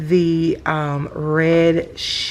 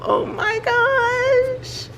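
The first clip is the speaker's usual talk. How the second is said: drawn-out, much higher squeal; delighted; much slower than usual. Real, not acted. Seeing the rabbit packaging, a slow high squeal.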